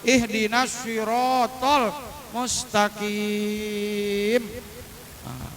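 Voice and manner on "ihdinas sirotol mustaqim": slow "sirotol" with rounded lips, long held "mustaqim"; rounded lips recitation. Shown as a demonstration. Teacher models the verse, "sirotol" rounded and "mustaqim" drawn out. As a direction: chant slowly, pouting on "sirotol". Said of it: "monyongin langsung".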